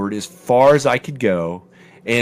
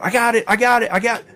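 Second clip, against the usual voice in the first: eager, high I got it; voicing an eager kid calling out; son's excited call; 11 semitones higher, faster than usual. Son filming the parachute drop, calling out high and eager.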